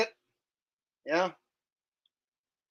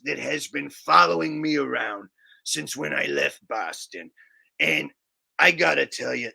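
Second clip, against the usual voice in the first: Richie from Boston; 6 semitones lower than usual. Pretend. Viewer requests Richie, streamer rambles in a Boston accent. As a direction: lower put-on Boston accent, rambling conspiracy monologue.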